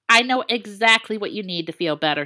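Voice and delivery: ordinary delivery, nothing marked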